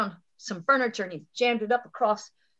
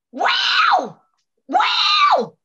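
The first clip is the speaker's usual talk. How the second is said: very high, loud panther yowl; imitated panther scream; 8 dB louder than usual. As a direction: screech like a wild cat close by.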